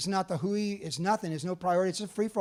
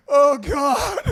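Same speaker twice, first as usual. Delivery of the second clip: panicked oh god; 8 semitones higher, 10 dB louder than usual. Retold or imitated. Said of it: "And I was like".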